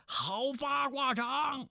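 high, drawn-out cheer; crowd cheer. A narrator's reading. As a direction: call it out like bandits roaring approval. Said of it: "群道轰然喝彩". (拖长高声喝彩)

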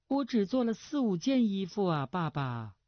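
put-on high girlish protest, falling away at the end; protesting daughter. A narrator's reading. Daughter defending her new clothes to father, coy protest. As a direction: whine back as a pampered girl.

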